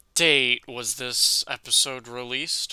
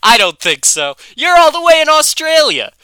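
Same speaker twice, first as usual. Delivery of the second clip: louder, higher cheeky taunt; mock the caller like a bratty kid; taunting Bart; 5 semitones higher, 8 dB louder than usual. Co-host voices Bart taunting.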